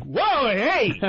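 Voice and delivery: high mock yelp of alarm; alarmed tough guy. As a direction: imitate a tough passenger suddenly spooked. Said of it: "even the most Texan tough guy passengers".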